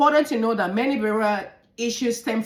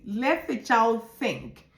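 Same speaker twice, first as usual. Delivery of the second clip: ordinary delivery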